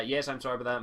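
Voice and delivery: ordinary delivery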